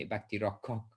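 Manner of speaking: Rakshak with a stretched last A, rokkhok; Bengali pronunciation. Shown as a demonstration. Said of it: "emphasis on that last A".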